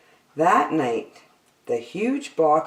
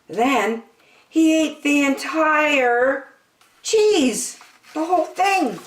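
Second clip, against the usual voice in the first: building excitement; 12 semitones higher, 3 dB louder, slower than usual. A narrator's reading. Narration building to climax.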